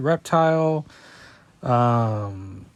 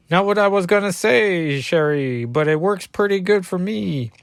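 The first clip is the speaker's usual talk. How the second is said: put-on higher-pitched Cajun accent; Gambit; 5 semitones higher than usual. Gambit answering Reptile's jibe, higher Cajun accent. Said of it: "I was taking over Gambit".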